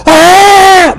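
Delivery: high mock scream on the ride; retold scream. Man on Disney ride, hammed-up high scream. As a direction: shriek like you're terrified of the drop. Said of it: "I start screaming".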